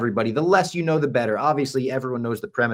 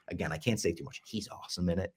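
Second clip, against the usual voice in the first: hushed, confidential aside, much softer; mock whisper; 4 semitones lower, 11 dB quieter than usual. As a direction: drop your voice as if sneaking out a secret. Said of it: "I'm whispering".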